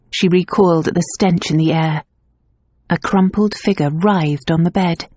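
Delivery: usual speaking voice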